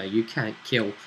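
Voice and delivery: usual speaking voice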